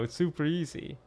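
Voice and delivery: usual speaking voice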